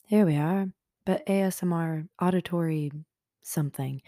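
normal talking voice

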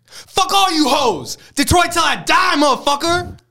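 high, loud, slow shouted rock star yell; [name] impression. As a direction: scream it like a rock star on stage. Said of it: "[name]".

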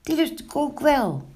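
high, loud cat voice; eager meowing cat. A narrator's reading. Snorrebaard begs for a pancake, high meowing cry. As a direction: meow your way into the words. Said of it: "riep Snorrebaard".

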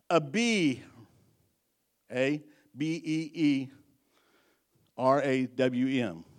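Abi, A B E E, R A W M spelled letter by letter, slowly. Shown as a demonstration. Pastor shows the congregation the Hebrew, spelling slowly. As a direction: sound out Abiram as A-B-E-E-R-A-W-M. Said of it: "looked it up in the Hebrew".

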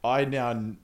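ordinary talk

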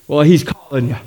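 ordinary talk

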